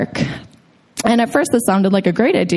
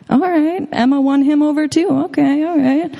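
giddy surprise; 6 semitones higher than usual. Retold or imitated. Giddy reaction to the gift.

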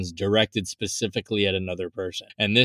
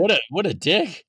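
mock outrage; 6 semitones higher than usual. Teasing co-host who met the actor.

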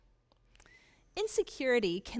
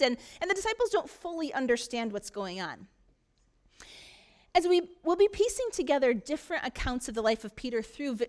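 ordinary delivery

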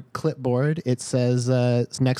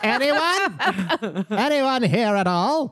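high calling-out voice; character voice; 9 semitones higher, much slower than usual. Pretend. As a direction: call out into the empty room.

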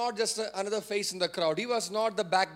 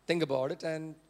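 low, hesitant, diplomatic suggestion; mild, filtered advice; 5 semitones lower, 3 dB quieter than usual. Acted. Preacher showing how he usually advises, low and tentative. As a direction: hedge it gently, as if afraid to insist. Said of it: "I get to speak like this only".

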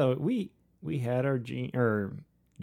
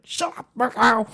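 mimicking wife; 10 semitones higher, slower than usual. Husband imitates her reply.

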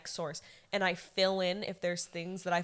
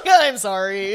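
laughing; 13 dB louder, slower than usual. Real, not acted. Laughing apology to Ryan.

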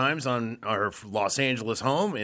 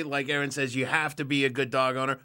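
normal talking voice

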